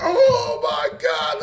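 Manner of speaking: high, loud, drawn-out mock horror; retold shock. Retelling the morning he saw his foot, shocked. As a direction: stretch it slowly into a high cry of dismay.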